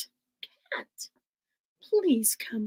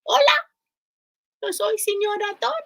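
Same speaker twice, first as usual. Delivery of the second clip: high squeaky puppet mouse; bright little puppet voice, pitched high; 7 semitones higher, 4 dB louder, faster than usual. Pretend. Teacher voices a mouse puppet for the class. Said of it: "I have a little friend here".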